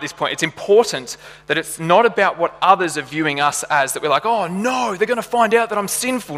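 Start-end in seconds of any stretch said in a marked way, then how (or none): 4.3-6.4 high-pitched mock worry; mock panic. We fear others finding out, mock panic. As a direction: fret aloud, pitched up, as if about to be found out. Voicing people worried about being exposed.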